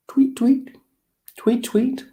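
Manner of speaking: very high chirping tweets; bird tweets. Shown as a demonstration. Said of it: "what sound these creatures make".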